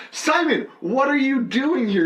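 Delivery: high, stretched-out mock dismay; exasperated comic, acted out; imitated exasperation. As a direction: plead with a heckler from the stage, hammed up. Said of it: "he's like".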